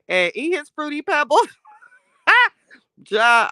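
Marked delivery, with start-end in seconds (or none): 2.3-3.5 cracking up mid-word; real laughter, denied after; laughing. Real, not acted. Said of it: "I'm not laughing".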